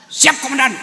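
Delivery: loud, high snappy reply; eager aide. Acted. Aide answering his commander, loud and eager. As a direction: snap to attention.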